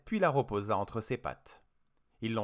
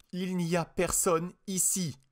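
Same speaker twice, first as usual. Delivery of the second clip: slow, detached syllables, higher voice; each syllable detached; 8 semitones higher, slower than usual. Acted. Vicky insiste auprès de Burt, chaque syllabe détachée. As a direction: spell it out slowly, one syllable at a time. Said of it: "insista Vicky en détachant chaque syllabe".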